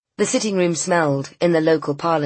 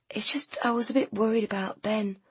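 worried, hushed and unsure; almost whisper the worry; hesitant whisper; 6 semitones higher, 5 dB quieter than usual. A narrator's reading.

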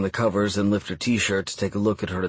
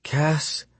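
higher, faint delirious question; soft feverish call; 7 semitones higher than usual. A narrator's reading. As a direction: barely breathe the name, higher. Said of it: "Then so softly".

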